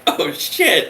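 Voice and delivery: comic alarm at a mistake; mock dismayed realisation. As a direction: react like you just blundered.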